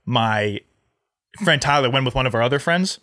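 normal talking voice

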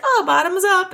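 playful goose going in for the rescue; cheery goose voice. Retold or imitated. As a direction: toss it off like a toast. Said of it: "And then they're like".

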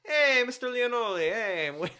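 high, loud hammed-up Italian accent; loud, silly mock-Italian voice; crude Italian accent. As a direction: do a cartoonish Italian patient. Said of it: "How come you still sound Italian?".

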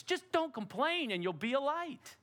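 ordinary talk, nothing marked

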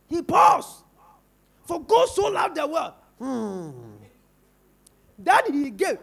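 loud preaching shout on For God so loved the world; shouted reading. Shown as a demonstration. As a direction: belt it out with a pause at the comma. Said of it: "You pause more".

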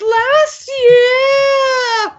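hammed-up child crying accusation; whiny kid shout. Son accusing his dad of broken promises, high wail. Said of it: "kid voice continued".

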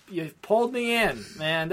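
ordinary delivery

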